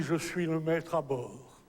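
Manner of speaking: ordinary talk, nothing marked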